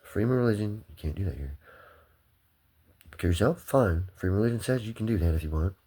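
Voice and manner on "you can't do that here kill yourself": ordinary talk, nothing marked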